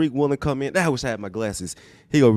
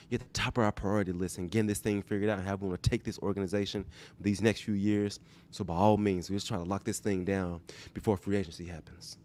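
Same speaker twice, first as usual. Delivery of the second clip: Pete Carroll impression; 2 semitones lower, 7 dB quieter than usual. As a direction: reassure the player like a coach who means it. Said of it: "I'm trying to mimic Pete Boyce 'cause he's gonna have a sincere".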